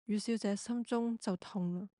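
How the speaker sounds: ordinary talk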